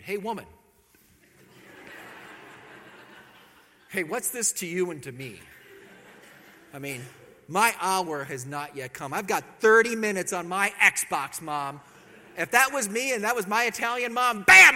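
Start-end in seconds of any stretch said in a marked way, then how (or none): 0.0-11.8 put-on sulky teenager mouthing off, getting louder; teen with an attitude. Johnny talking back to his mom, mock attitude. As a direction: sass your mom like a bored teen. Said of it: "comes down like this with an attitude".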